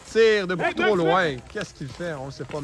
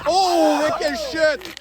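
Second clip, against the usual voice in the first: high, loud surprised call; excited; 10 semitones higher, 5 dB louder than usual. Real, not acted. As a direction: react to the fall with a cry. Player falls, commentator reacts.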